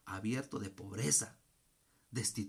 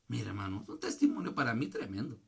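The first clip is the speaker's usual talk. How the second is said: ordinary talk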